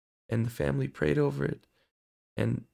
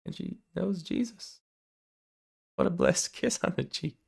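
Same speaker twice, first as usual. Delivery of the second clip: much higher, amazed gushing; excited inner gush; 10 semitones higher than usual. Retold or imitated. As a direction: squeal inside with delight at the blessing. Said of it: "I'm like".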